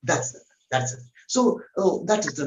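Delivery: normal talking voice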